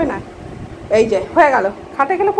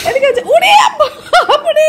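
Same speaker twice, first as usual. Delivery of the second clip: higher, louder laughing yelp; laughing shout; 7 semitones higher, 4 dB louder, slower than usual. Real, not acted. Startled by the pet, laughing loud yelp. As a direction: burst out laughing in shock.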